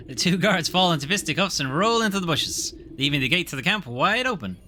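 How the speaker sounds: story narration. Acted. Reading game narration.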